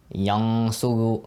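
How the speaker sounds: yang suru very slow, the n kept humming in the nose; ghunna held in the nose. Shown as a demonstration. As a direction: stretch yang suru, nasal hum on the noon. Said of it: "keeping the sound in your nose".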